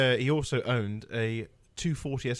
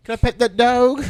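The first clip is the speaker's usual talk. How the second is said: louder, higher meme quote; 9 semitones higher, 12 dB louder than usual. Retold or imitated. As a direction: belt the meme line, laughing. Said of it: "we're like".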